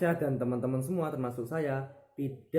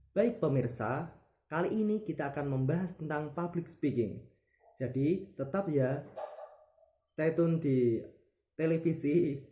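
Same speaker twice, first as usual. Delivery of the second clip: TV presenter voice. Shown as a demonstration. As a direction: clear announcer read to the viewers. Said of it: "Contoh seperti ini". (gaya pembawa acara televisi yang jelas)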